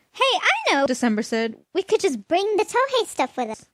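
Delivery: high, bright suggestion; high excited girl voice; girl's voice. A narrator's reading. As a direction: chirp up like a young girl with an idea.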